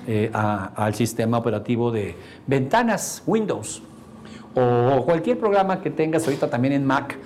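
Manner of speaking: ordinary delivery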